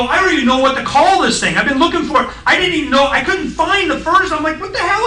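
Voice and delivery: high, rising exasperated rant; frantic what-the-hell outburst; excited disbelief. Retold or imitated. Retelling his own excited confusion.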